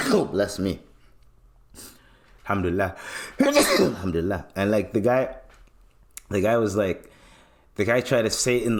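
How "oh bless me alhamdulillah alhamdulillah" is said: ordinary talk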